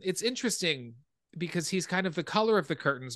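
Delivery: usual speaking voice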